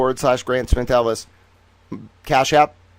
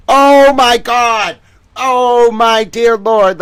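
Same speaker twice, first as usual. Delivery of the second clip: slow, much louder and higher hammed-up shock; stunned exclamation; 10 semitones higher, 11 dB louder, much slower than usual. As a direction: drag out the disbelief like a stage gasp. Reacting to shocking document.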